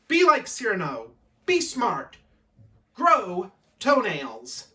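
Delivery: punchy announcer tagline, slowing at the end; mock advert slogan. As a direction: land each slogan like a commercial.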